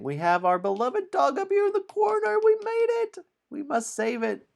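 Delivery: very high, loud, hurried squeal; excited squeal. Pretend. Host points out the beloved dog, high excited squeal. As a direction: squeal it with mock urgency.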